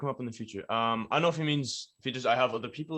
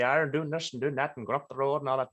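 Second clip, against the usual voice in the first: broad Donegal Irish accent, pitched up; thick Donegal accent; 4 semitones higher than usual. Shown as a demonstration. Rapper showing fans what a fake-sounding Donegal accent would be, broad Donegal accent. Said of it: "come on with the thickest Donegal accent ever".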